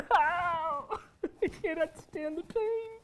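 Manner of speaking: high anguished moan; pained cry. Acted. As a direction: cry out in pain. Starving man in pain.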